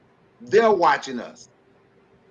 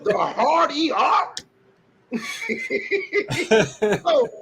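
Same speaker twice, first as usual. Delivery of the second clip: "The hard E R?" much higher, laughing, rising at the end; incredulous laughing repeat; 8 semitones higher, slower than usual. Real, not acted. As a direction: repeat it slowly, high and incredulous, laughing through it. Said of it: "They say it with a hard E R".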